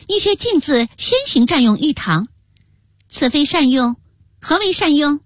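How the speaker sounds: high-pitched imperious accusation; indignant, declaiming. A narrator's reading. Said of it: "便大声道". (高声质问)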